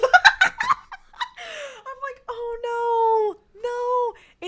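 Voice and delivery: very high, loud cry of dismay; high-pitched comic despair; laughing wail. Real, not acted. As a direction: wail it while breaking into laughter. Reliving distress at the rusty nail.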